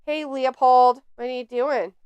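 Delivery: ordinary talk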